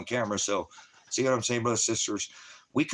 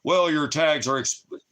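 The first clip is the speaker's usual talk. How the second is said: mock cop voice; 6 semitones higher, 5 dB louder, slower than usual. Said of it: "they kinda like".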